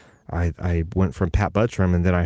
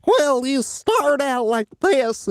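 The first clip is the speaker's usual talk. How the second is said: high, slow, drawn-out character voice; high-pitched cartoonish anchor voice; much slower than usual. Shown as a demonstration. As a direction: stretch it out in a high put-on voice. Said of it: "I call it your anchor voice".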